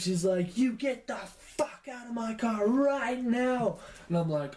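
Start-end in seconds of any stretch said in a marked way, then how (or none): 0.6-3.8 high, drawn-out mock scream; retold scream. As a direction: imitate an enraged mom screaming, slowly stretched. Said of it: "she screams".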